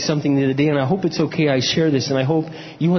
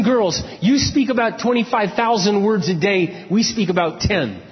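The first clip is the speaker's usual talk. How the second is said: normal talking voice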